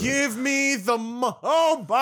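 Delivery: high-pitched, drawn-out outburst; stunned disbelief. Real, not acted. Co-host hearing the long betting odds, stunned disbelief. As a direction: react in loud, rising amazement.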